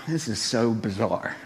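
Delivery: low, slow, hushed disbelief; uneasy aside. Retold or imitated. Husband taking in the bizarre couples room, uneasy aside. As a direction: mutter it slowly to yourself. Reacting to odd massage room.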